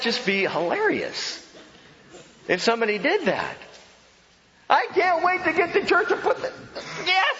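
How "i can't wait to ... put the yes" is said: very high, bouncy, hammed-up eager voice; mock overexcited churchgoer; gushing eagerness. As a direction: gush like you can't get to church fast enough.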